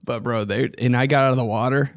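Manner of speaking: normal talking voice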